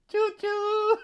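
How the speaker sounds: put-on high choo choo like feeding a baby; train noise.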